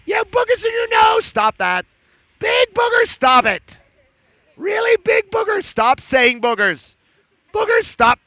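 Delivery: high playful telling-off; mock scolding. Storyteller teasing the cow puppet, high mock scolding. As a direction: tease the puppet in a squeaky voice.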